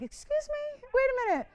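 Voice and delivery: high, indignant excuse me; mock-offended disbelief, voice jumping high; mock outrage. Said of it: "And I'm like".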